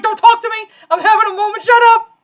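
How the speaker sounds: high, loud, choked-up outburst; tearful yell. Retold or imitated. Retelling yelling at brother while crying, loud and high. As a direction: push him away while crying. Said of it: "I'm like".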